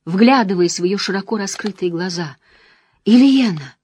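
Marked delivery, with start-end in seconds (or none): 3.1-3.8 desperate shout of a name; anguished cry. Acted. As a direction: cry out her name in grief. Husband finds dead wife.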